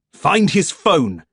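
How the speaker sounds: pressing command to the invisible army; urgent order. A narrator's reading. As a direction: spell out the order with force.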